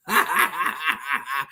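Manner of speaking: a deep, derisive chuckle; low scoffing laugh. Real, not acted. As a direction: laugh it off, mocking. Mocking silent comments.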